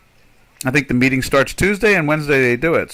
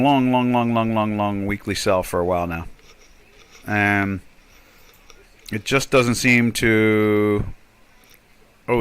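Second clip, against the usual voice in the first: ordinary talk, nothing marked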